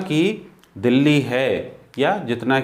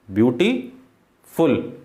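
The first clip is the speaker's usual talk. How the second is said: Beautiful very slow, long pause before फुल; split into syllables; 3 semitones lower, much slower than usual. Shown as a demonstration. Teacher showing more than one syllable, word pulled apart. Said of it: "यहाँ syllable एक से ज़्यादा है".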